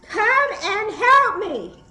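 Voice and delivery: slow, high, loud call for help; yell. Shown as a demonstration. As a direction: stretch it out so neighbours hear. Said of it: "Everybody yell".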